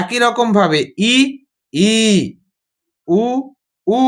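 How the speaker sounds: ই short then held long, উ short then held long; short and long vowels. Shown as a demonstration. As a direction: stretch the second ই and উ to double length. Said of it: "এটাই system". (হ্রস্ব ও দীর্ঘ ই উ টেনে বলা)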